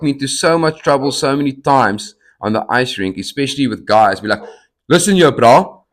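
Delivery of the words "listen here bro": higher, louder mock confrontation; imitating an angry boyfriend; tough-guy threat. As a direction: act the angry bloke squaring up.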